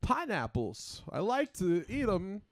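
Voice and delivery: slow, high, oddly paced Walken; drawn-out, halting Walken delivery; Christopher Walken voice. Retold or imitated. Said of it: "talking really deep".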